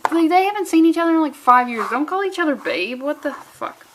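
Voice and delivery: ordinary talk, nothing marked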